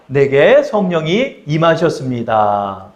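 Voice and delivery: slow, low, measured declaration; call-and-repeat lead. Shown as a demonstration. Preacher leads congregation to repeat after him, slow recitation. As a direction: spell it out slowly for repeating. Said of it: "저를 한번 따라하겠습니다".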